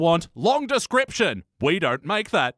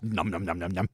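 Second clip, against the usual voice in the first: munching noises; 10 semitones lower, 8 dB quieter than usual. Pretend. Mimicking Wiggum's butt eating.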